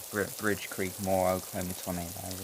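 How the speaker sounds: ordinary talk, nothing marked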